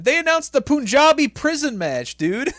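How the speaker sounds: high, loud, thrilled announcement; excited. Real, not acted. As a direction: shout the big news with glee. Excited wrestling news.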